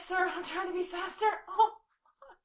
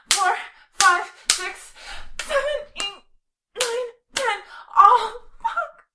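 counting louder through pain; 5 semitones higher, 8 dB louder than usual. Real, not acted. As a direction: push out each number through pain.

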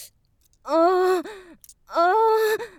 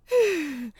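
satisfied sigh. Acted. Afterglow sigh.

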